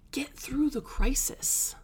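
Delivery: unvoiced, breathy whisper. Real, not acted. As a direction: drop into an intimate whisper. Sudden whispered emphasis.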